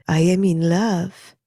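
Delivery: slow, dreamy French teacher; dazed lover. Acted. Teacher waking to Margaret, slow and dreamy. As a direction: drift it out slowly, lovestruck.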